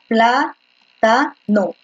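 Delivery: plátano drawn out slowly, syllable by syllable, PLA-ta-no; slow syllable split. Shown as a demonstration. Teacher slowly splitting the word for the class: plátano as PLA-ta-no. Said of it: "voy a dividirlo".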